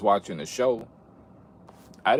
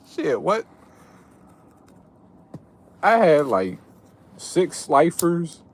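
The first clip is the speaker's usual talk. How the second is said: excited; 5 semitones higher, 7 dB louder, slower than usual. Real, not acted. Boasting card haul, laughing.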